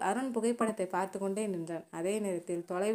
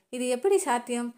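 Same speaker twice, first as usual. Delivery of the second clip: higher, louder shocked question; 4 semitones higher, 5 dB louder than usual. A narrator's reading. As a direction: gasp it out in shock. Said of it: "அதிர்ச்சியுடன் கேட்டான்".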